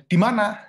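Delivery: high, loud one-line demand; curt questioning. Retold or imitated. Spouse texting after marriage, curt high question. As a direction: fire it off as a blunt question. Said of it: "tanda tanya".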